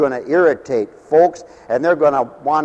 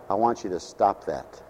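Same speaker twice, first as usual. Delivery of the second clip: much softer, lower, firm but hushed; real quietly; 6 semitones lower, 6 dB quieter than usual. Retold or imitated. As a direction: calmly shut down a screaming woman. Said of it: "real quietly".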